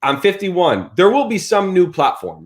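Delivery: ordinary delivery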